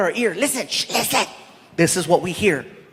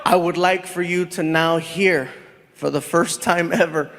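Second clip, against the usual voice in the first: announcer; 6 semitones lower than usual. Acted. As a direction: introduce the guest like a host. MC introducing guest.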